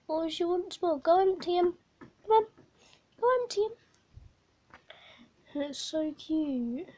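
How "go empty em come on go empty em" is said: high, louder sing-song coaxing to a hamster; coaxing pet. Real, not acted. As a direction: egg the little pet on, rising in pitch. Urging hamster to empty pouches.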